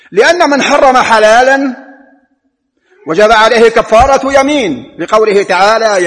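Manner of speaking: insistent Friday sermon voice; forceful preaching. Acted. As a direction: preach the ruling from the pulpit with force.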